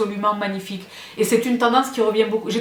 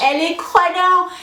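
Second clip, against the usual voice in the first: high, theatrical squeal of admiration; gushing exclamation; 8 semitones higher, 4 dB louder than usual. Real, not acted. Admiring the demijohn for viewers, high gushing squeal. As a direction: gush high over a treasured object.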